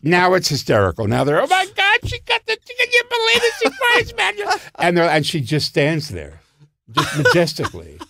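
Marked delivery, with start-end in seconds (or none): 1.4-4.3 much higher, incredulous squealing voice; a hammed-up tittering spectator; giggling audience member. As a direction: squeal like a scandalized theatregoer. Voicing the laughing audience.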